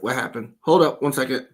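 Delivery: usual speaking voice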